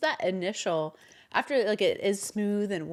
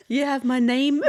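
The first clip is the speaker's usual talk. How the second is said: Scottish "ye have my name" with ye for you; Scottish accent; 5 semitones higher, 6 dB louder than usual. Acted. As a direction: say "ye" not "you", Scottish lilt. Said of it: "it should be a ye".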